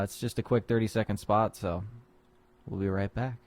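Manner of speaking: ordinary delivery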